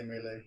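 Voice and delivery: melee said as "MAY-lay"; MAY-lay pronunciation. Shown as a demonstration. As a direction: say melee with two AY vowels. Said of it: "I think I say".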